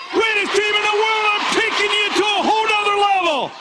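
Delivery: high sustained rallying shout; all-out yell. Real, not acted. Coach yelling pride to the whole team, high full shout. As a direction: yell it out over a cheering crowd. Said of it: "I'm gonna yell one this time".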